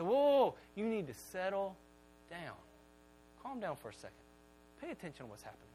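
put-on calming the kids. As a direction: soothe them down to quiet. Calming his excited kids.

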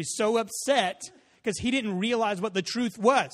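ordinary delivery

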